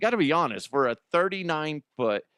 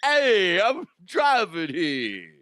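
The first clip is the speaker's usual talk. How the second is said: loud, higher, slow mock New Jersey street yell; New Jersey accent; 6 semitones higher, 4 dB louder, much slower than usual. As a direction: shout it like a New York-area cabbie. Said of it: "what New Jersey people sound like".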